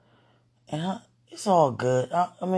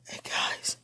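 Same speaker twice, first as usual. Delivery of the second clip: softer unvoiced hello; whispered greeting; 6 dB quieter than usual. Real, not acted. Greeting viewers while overcome, whispering. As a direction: whisper it to the camera.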